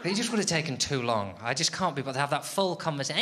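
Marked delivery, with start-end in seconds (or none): none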